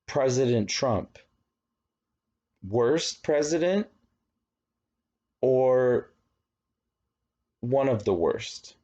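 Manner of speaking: slow, pointed question steering toward worst; loaded poll question. Shown as a demonstration. Teacher acting a biased pollster, slanted against. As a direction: lean on the negative, pausing to push the answer. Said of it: "And then you have a bad question".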